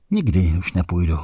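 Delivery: ordinary delivery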